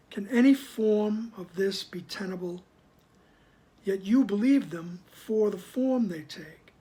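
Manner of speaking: slow, firm reading of the text; formal reading. Acted. Teacher reading the passage to the group, slow and deliberate. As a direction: read it out slowly and deliberately.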